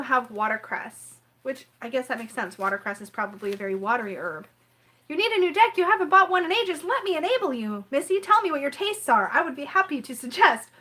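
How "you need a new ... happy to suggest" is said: higher, louder eager deck pusher voice; pushy enabler. Retold or imitated. Tarot friend urging her to buy a deck, higher and louder eager enabler voice. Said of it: "as would probably most people in this room".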